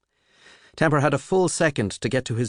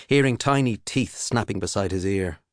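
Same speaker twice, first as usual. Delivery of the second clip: plain reading voice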